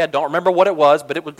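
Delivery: ordinary delivery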